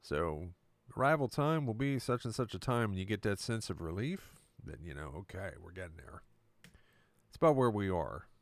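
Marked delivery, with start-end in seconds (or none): 0.0-2.9 upbeat airline pilot on the intercom; cheerful captain announcing the arrival; pilot announcement. Retold or imitated. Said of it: "when the pilot comes on and says".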